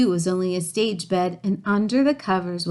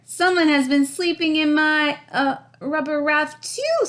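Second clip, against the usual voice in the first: Mama Bear; 8 semitones higher than usual. A narrator's reading. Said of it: "said Mama".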